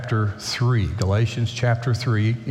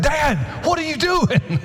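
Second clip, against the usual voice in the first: mock yell in a much higher voice; son's alarmed yell. Son yelling at his dad for lifting the granddaughter, high mock yell. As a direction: imitate a son yelling, pitched way up. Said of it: "My son yells at".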